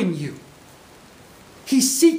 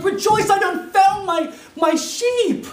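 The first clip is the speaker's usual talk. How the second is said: much higher and louder, bursting with joy; excited shout; 12 semitones higher, 5 dB louder, slower than usual. Pretend. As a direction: shout the news to the neighbours, overjoyed. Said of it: "He's excited".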